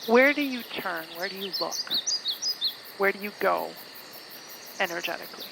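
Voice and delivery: phrases spaced out by long, unhurried pauses; guided meditation. Acted. Guide asking listeners to become aware of their body, guided meditation voice. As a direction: lead them inward, letting each phrase settle.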